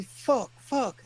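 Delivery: mock panic, yelped high; panicked swearing. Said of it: "like this".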